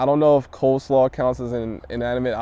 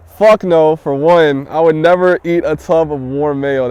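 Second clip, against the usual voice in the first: loud, raised-pitch flat-out no; big, adamant rejection of a disgusting idea; emphatic refusal; 4 semitones higher, 9 dB louder than usual. Real, not acted. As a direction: refuse it loudly and forcefully. Emphatic refusal of gross hypothetical.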